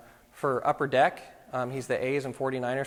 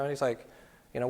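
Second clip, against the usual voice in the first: normal talking voice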